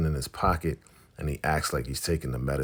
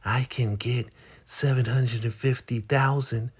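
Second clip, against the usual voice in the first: Joan's voice; 9 semitones higher than usual. Retold or imitated. Joan boasting to Ruth about the money, put-on voice. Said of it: "Joan's voice".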